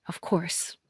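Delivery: bitter whisper; quiet resigned whisper to herself; whispered aside. A narrator's reading. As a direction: breathe it out under your breath. Bitter inner thought at table.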